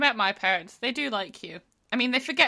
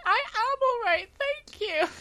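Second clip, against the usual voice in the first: high chirpy reply; high prim voice. Pretend. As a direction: answer primly and high. Playful reply.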